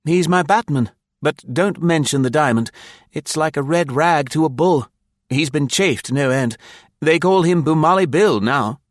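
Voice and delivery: put-on high, youthful young officer's voice; Blaine. A narrator's reading. Young officer describing his batman to a senior, high and youthful. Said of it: "said the youthful Blaine".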